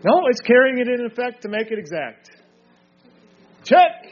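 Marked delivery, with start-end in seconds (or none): 3.6-4.1 loud, high single-word call; sudden raised shout of victory; calling out a point. Real, not acted. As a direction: call it sharply like a chess move. Calls out a point scored.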